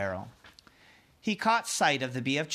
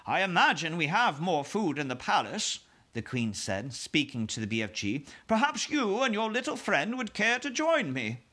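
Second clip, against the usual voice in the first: queen voice; 4 semitones higher than usual. Acted.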